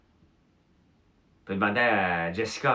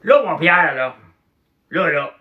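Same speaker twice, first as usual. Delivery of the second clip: booming, much higher and louder; Claude Cloutier's big voice; 8 semitones higher, 13 dB louder, slower than usual. Retold or imitated. Claude Cloutier booming at Pierre over beer. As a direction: bellow it like a rowdy drinking buddy. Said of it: "il parlait avec une grosse voix".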